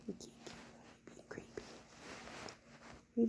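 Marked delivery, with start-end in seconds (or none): 0.1-1.6 soft playful whisper. Real, not acted. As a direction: whisper it to the sneaky pet. Playful whisper to sneaking pet.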